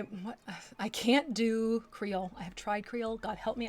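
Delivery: usual speaking voice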